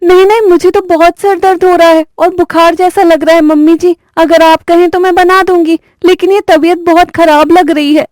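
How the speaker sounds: high voice, putting on a sickly whine; faking illness. Acted. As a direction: bride pretending to be ill to escape cooking. (ऊँची आवाज़ में बीमारी का नाटक करती बहू)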